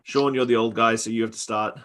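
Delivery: usual speaking voice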